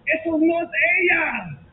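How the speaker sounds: a mother shouting a warning to the church; mother's warning. Retold or imitated. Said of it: "Y mi mamá dice".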